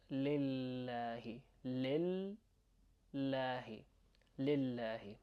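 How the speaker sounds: lillahi with the L held a little; moderately held L in lillahi. Shown as a demonstration. Teacher telling learners not to go overboard, lightly held L in lillahi. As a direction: hold the L of lillahi without overdoing it. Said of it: "don't have to go overboard".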